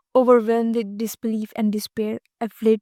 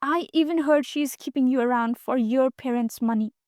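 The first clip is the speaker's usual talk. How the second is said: ordinary delivery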